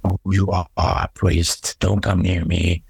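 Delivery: low, whispered-then-growled demon voice; imitating a stock horror demon, low and menacing; mock movie demon. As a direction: play the cliché exorcism demon, dropped low. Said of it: "the voice of the, of the demon is always like".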